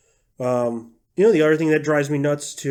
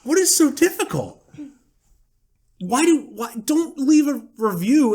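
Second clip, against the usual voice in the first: high-pitched, incredulous rant; exasperated; 12 semitones higher, 3 dB louder than usual. Real, not acted. Ranting at refund-demanding reviewers, voice high with exasperation. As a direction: let the voice shoot up in exasperation. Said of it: "Drives me nuts".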